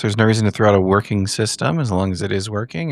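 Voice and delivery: ordinary delivery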